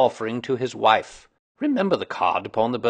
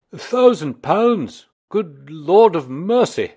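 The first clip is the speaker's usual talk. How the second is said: slow, high, loud amazed cry; astonished; 7 semitones higher, 8 dB louder, slower than usual. A narrator's reading. Commissionaire hearing of the reward, loudly astonished. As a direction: gasp it out, stunned by the sum.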